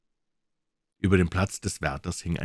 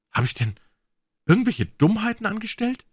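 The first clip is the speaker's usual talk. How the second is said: rising high frightened question; high worried question; anxious question. A narrator's reading. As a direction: plead in fear. Frightened prisoner asks guard.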